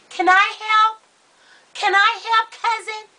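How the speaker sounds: high, sweet little cousin; little girl voice. Retold or imitated. Little cousin offering to help with the scissors, high child voice. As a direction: pipe up like an eager child.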